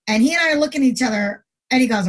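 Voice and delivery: ordinary delivery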